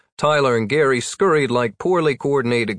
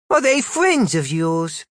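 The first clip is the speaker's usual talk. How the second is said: put-on little girl voice; 6 semitones higher, slower than usual.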